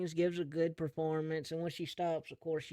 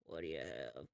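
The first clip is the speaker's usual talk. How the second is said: very low, quieter barman voice; waiter; 9 dB quieter than usual. Acted. Waiter at the bar asks, deep and quiet. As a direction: ask for the order from deep down.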